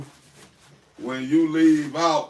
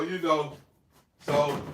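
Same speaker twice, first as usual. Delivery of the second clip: usual speaking voice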